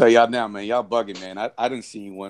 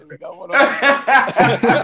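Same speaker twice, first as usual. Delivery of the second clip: laughing; 12 semitones higher, 4 dB louder, much slower than usual. Real, not acted. Teasing, breaks into laughter.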